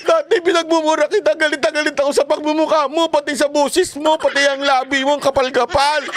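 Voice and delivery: high, mock-sheepish whiny apology with a laugh. Mocking former critics who now admit being fooled, high whiny apology. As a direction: ham up a fooled supporter begging forgiveness.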